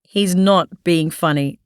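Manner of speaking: usual speaking voice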